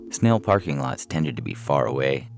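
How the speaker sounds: usual speaking voice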